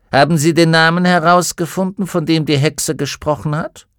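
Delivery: brisk, probing interrogator; stern inquisitor. Acted. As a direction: press the witness quickly. Inquisitor presses witness.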